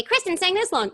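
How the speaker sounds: very high-pitched soprano starlet. Shown as a demonstration. Quick voice switch beside [name], high starlet impression. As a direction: jump way up into a high star voice. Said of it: "somebody like [name]".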